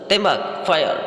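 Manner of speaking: high, short demonstrated orders; imitated order with an exclamation mark; exclamatory command. Shown as a demonstration. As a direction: fire off each word as a command. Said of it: "tanda seru".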